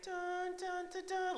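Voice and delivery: high mock doom jingle; playful dun dun dun dun; ominous dun dun. Mock doom sound when word dies.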